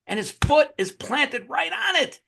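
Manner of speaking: high, drawn-out climax; amazed storytelling. Real, not acted. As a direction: build the surprise with pitch way up. Climax of retold film scene.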